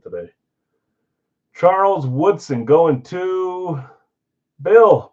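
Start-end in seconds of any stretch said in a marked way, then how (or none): 1.5-5.1 drawn-out, higher-pitched reveal, name punched loud; winner announcement. Real, not acted. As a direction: stretch the lead-in, then hit the winner's name. Announcing who wins the jersey.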